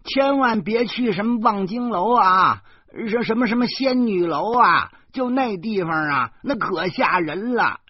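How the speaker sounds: put-on high, loud scolding from an old mother, slowing at the end; an old mother lecturing loudly about deadly cliffs. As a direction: nag the crowd like a fretful old mother. Mother scolding son in crowd.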